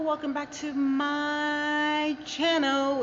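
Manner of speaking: high, slow, drawn-out hello; sing-song greeting. Acted. Vlog intro greeting in garage.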